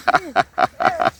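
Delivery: high staccato chuckle; light, quick giggle; laugh. Real, not acted. Laughs at own antics.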